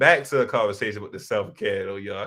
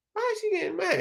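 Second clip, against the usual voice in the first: confused; 3 dB quieter, faster than usual. Retold or imitated. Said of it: "I'm like".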